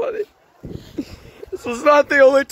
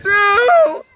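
hammed-up sobbing shout, high; mock crying wail; 11 semitones higher than usual. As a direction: wail that everyone's leaving. Mock despair, everyone moving away.

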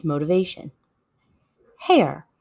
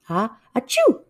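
acted sneeze; 4 semitones higher than usual. Shown as a demonstration. Demonstrating a sneeze.

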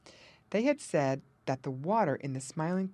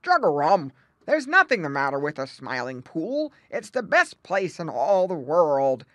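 loud, gruff croaking retort; gruff old frog; 8 dB louder than usual. A narrator's reading. Grandfather Frog dismisses the youngsters' worry, gruffly. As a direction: growl it like a grumpy frog. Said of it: "replied Grandfather Frog gruffly".

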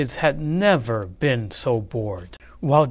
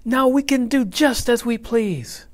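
put-on higher, louder gleeful kids; gloating kids; 11 semitones higher, 4 dB louder than usual. A narrator's reading. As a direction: kids crowing over their win. Class gloating.

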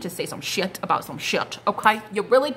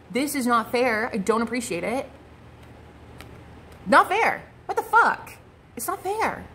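mock protest. Tarot reader voicing the querent telling them off, outraged. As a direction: complain it's unfair, climbing into a shrill outburst. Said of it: "you need to just tell them".

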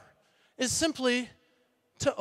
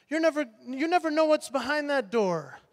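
ordinary talk, nothing marked

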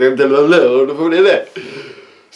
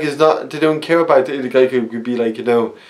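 usual speaking voice

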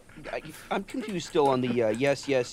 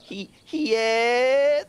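peppered mock moan; 9 semitones higher, 5 dB louder, much slower than usual. Shown as a demonstration. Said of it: "put a little pepper on the end".

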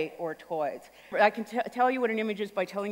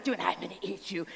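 put-on soft menacing kid; 8 dB quieter than usual. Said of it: "he's like".

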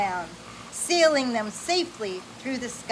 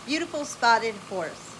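plain reading voice

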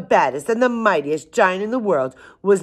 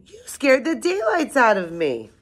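put-on high, startled storybook old woman; startled cry; 8 semitones higher than usual. A narrator's reading. Old woman scolds the elephant for scaring her, startled. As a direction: exclaim it high, as if given a fright. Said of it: "cried the tiny old woman".

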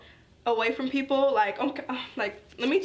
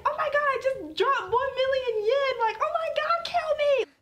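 mock distraught wail. Girl sobbing in the stall over lost money, very high hammed-up wail. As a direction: wail it like it's the end of the world.

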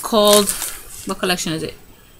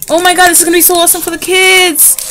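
high, loud and fast, tumbling out; excited rush; 9 semitones higher, 5 dB louder, faster than usual. Real, not acted. Mom showing viewers a kit for her kids, fast excited gush.

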